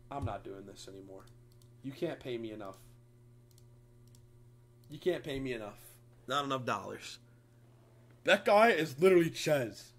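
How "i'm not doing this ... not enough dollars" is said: much lower and softer, done with it; fed-up quitting. Real, not acted. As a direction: drop the act, totally over it. Quits the reading, deflated.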